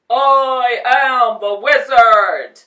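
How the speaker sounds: slow, loud booming voice; booming giant head. Acted. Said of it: "boomed a giant head".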